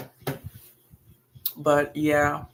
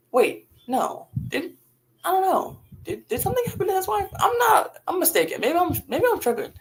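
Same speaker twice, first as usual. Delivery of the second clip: much higher, flustered second-guessing; sudden confusion. Real, not acted. To viewers, realizing she may have misremembered Job, flustered and much higher. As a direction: catch yourself mid-thought, unsure. Suddenly doubting her Bible recall.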